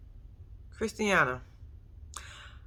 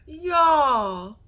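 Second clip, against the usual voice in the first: high, slow cry of disbelief; emotional outcry; 7 semitones higher, much slower than usual. Real, not acted. Viewer overwhelmed by a TV scene, crying out. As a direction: let it rise and break in disbelief.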